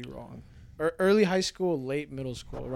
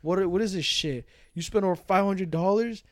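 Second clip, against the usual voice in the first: mildly raised, higher confronting voice; imitated angry dad; 4 semitones higher, 5 dB louder than usual. Dad confronts son over phone purchases, mock indignant. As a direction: lightly act out a father demanding an explanation.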